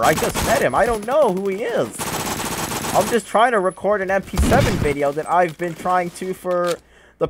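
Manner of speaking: screaming in high-pitched rage; shrieking furious at the game; berserk rage. Real, not acted. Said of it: "I literally screamed my entire throat out".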